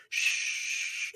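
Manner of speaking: a soft breathy hush like leaves rustling; wind in trees. Retold or imitated. Describing the dark deck at night, a soft rustling hush.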